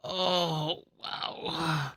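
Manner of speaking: breathless, stomach aching from laughter; winded after laughing hard. Real, not acted. After long laughing fit.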